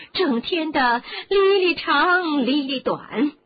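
high, loud, ranting aunt; shrill shouting aunt. Acted. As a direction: screech the resentment out. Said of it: "尖着嗓子喊起来".

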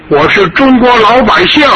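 a grandfather's proud, high cry; proud declaration. Acted. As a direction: proclaim it with pride.

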